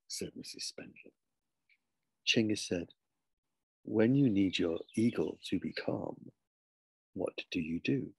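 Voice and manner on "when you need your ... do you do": slow, low, deliberate question; calm, ominous boy. Acted. Boy challenging his teacher, slow calm question. Chinggis puts a pointed riddle.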